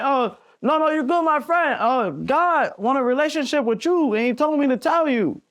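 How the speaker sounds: high-pitched imitation Mexican accent; Mexican-accented English, high and halting. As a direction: imitate a friendly stranger with a heavy Mexican accent. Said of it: "he's saying all that with an accent".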